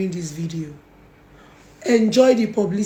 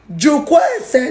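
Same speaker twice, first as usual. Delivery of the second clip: Jukwa ese shouted higher and louder; shouting Jukwa ese at her, the way she shouldn't; loud shouted version; 6 semitones higher, 5 dB louder than usual. Shown as a demonstration. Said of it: "No be by shouting".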